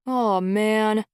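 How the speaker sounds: loud whiny gripe; complaining. Acted. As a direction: moan about the empty bag. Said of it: "Ez complained".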